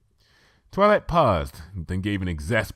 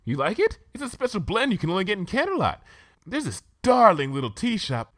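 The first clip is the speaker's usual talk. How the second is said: much higher, posh-sounding unicorn lady, chatty; Rarity, refined lady; 12 semitones higher than usual. Acted. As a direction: play the prim fashion pony gushing about tea. Rarity voiced by reader.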